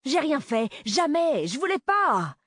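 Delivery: acted frantic protest; panicked pleading. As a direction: beg for your life, voice shooting up. Boy begs at gunpoint.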